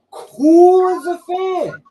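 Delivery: loud, high, stretched words; drawn-out emphasis. Real, not acted. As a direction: draw it out with loud amazement. Hyped retelling of fighter's entrance.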